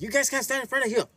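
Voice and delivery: imitating the angry owner. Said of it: "He was mad".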